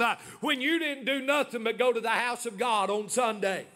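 ordinary talk

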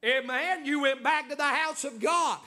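ordinary delivery

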